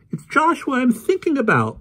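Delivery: put-on very high, loud girl's cry. Polly crying out to Adam, very high loud girl. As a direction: insist through grief. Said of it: "Polly cried".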